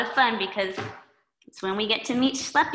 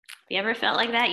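plain reading voice

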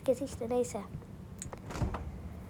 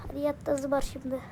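ordinary talk

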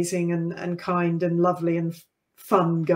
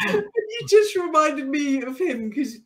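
laughing; 9 semitones higher than usual. Real, not acted. Recovering from laughing fit at memory.

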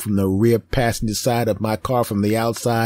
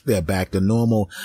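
normal talking voice

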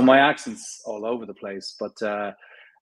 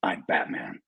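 lower, narrow and short Batman line; 8 semitones lower, 5 dB quieter than usual. Retold or imitated. Actor demonstrating his Batman voice for the host. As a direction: keep it low, flat and direct. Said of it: "for Batman ... narrow vocal range".

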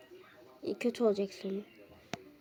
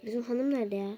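hushed whisper, asking where the wife is; whispered question; 3 semitones lower, 5 dB quieter than usual. Real, not acted. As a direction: whisper it so she won't hear. Dad looking for his wife.

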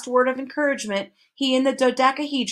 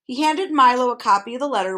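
usual speaking voice

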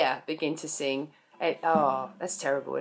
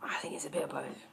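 soft, whispered joke aside; a conspiratorial whispered quip; 5 semitones lower, 14 dB quieter, faster than usual. Real, not acted. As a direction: drop to a whisper for the punchline.

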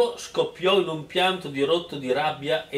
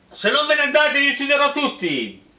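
higher, louder mock shout; hero's yell; 10 semitones higher, 4 dB louder than usual. The hero threatens the suitors, hammed-up yell. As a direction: belt the threat like a cartoon hero. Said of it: "urlò l'eroe".

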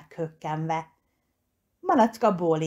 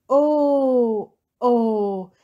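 mock lament; 6 semitones higher, 6 dB louder than usual. A narrator's reading. Piglet laments burst balloon.

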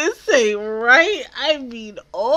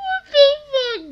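shocked cry; 12 semitones higher than usual. Real, not acted. Shocked reaction.

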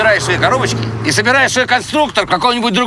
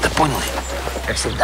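grumbling; 9 semitones lower, 7 dB quieter than usual. Acted. Grumbling reply to the boss.

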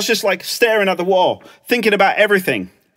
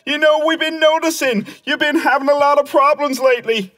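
higher-pitched mimicry of a meddler; nagging concerned someone; 7 semitones higher than usual. Someone urging Clem to talk it out, pestering concern. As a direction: imitate someone pressing unwanted advice. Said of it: "there's always someone there going".

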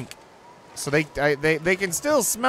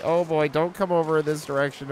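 usual speaking voice

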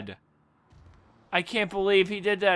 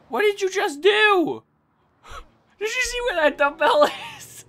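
high, gasping, laughing disbelief; can't believe what just happened; shocked disbelief; 13 semitones higher than usual. Real, not acted. Gamer shocked after being hit with a dumbbell.

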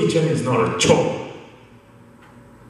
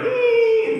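high, thin dog whine; dog's whimper. Retold or imitated. Imitating Coco the dog with tail tucked, whimpering. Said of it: "with tails between the legs".

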